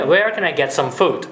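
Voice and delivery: "Where can I get some food" slowly, pitch dropping at the end; slow wh-question model, "food" going down; falling question ending. Shown as a demonstration. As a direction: let the voice fall on "food". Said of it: "語尾下がりますね".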